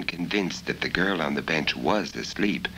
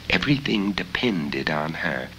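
ordinary delivery

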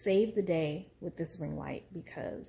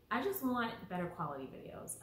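normal talking voice

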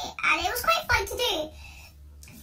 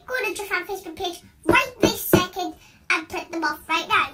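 normal talking voice

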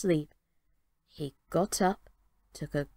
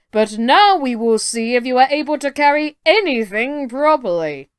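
booming giant; 4 semitones higher, 8 dB louder than usual. Acted. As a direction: boom it out like a proud giant. Giant sets new test.

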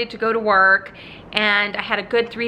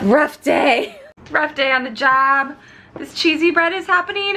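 bright sing-song; 5 semitones higher than usual. Shown as a demonstration. Flight attendant chirping to her viewers about her rough day. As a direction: bounce it up brightly.

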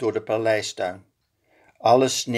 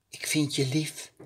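much softer tender line; soft as snowflakes; 4 semitones higher, 6 dB quieter than usual. A narrator's reading. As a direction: whisper your love gently. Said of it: "zo zacht als sneeuwvlokjes". (zo zacht als sneeuwvlokjes)

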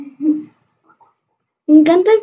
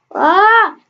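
yelling across the room; 6 semitones higher than usual. Real, not acted. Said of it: "Perdón por gritar".

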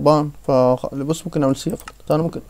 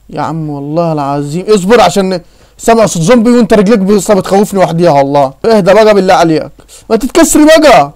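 loud, higher, alarmed complaint; panicky pleading; 3 semitones higher, 6 dB louder than usual. Real, not acted. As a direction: plead louder and louder as the zombie nears. Scared by zombie sounds in game. (باستعجال وخوف وصوت عالي)

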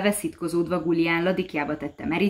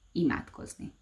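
whisper; 8 dB quieter than usual. Acted. Devil names the one forbidden act.

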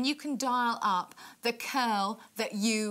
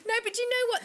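laughing; 12 semitones higher, much faster than usual. Real, not acted. Laughs at own joke.

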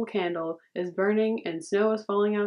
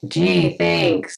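sarcastic; 3 semitones lower, 7 dB louder than usual. Pretend. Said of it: "kind of face".

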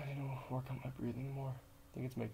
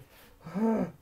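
pained laugh; 7 semitones higher, 9 dB louder than usual. Real, not acted. Pained laugh during plank.

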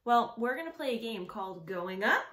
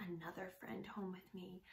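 whisper; 4 semitones lower, 14 dB quieter than usual. Acted. Secret reveal to children.

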